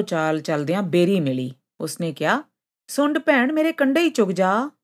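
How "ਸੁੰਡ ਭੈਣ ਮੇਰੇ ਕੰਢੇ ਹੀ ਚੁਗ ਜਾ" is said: high-pitched storybook character voice; pleading tree. A narrator's reading. Berry tree asks Sund for help, high pleading voice. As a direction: make a small request, pitched well up.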